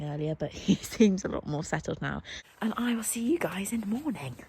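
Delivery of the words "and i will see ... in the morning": hushed night sign-off; whispering. Real, not acted. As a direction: whisper the goodbye.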